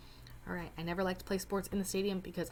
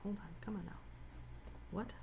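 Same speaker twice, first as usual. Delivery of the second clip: whispered aside; 14 dB quieter, faster than usual. Real, not acted. Muttered aside while fumbling.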